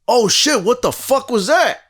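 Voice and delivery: high-pitched startled exclamation; retold startled reaction. Teller reliving his first reaction to the thing, startled.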